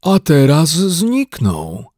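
very slow mournful bear; drawn-out grieving; lamenting. A narrator's reading. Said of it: "lamentował Paddington". (żałosny lament)

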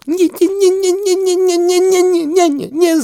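a distraught duck refusing to believe; duck wailing no. Acted. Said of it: "zawołała, biegać w kółko".

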